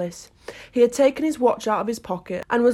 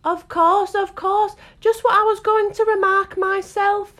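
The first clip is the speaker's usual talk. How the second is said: high, impatient sleepy mumble; sleepy impatient Dormouse; 12 semitones higher, 5 dB louder than usual. A narrator's reading. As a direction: grumble it without waking. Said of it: "said impatiently without opening its eyes".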